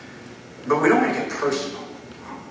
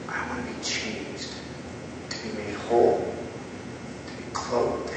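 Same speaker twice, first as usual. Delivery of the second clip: earnest prayer; 3 semitones lower, 10 dB quieter than usual. Acted. Penitent asks the Lord to be healed, prayerful. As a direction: plead gently, pausing after each wish. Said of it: "go before the Lord and say".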